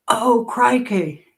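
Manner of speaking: high, drawn-out Aussie exclamation; hammed-up Australian dismay, stretched out; Australian accent. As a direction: mimic a startled Australian, slowly. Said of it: "they're Australian".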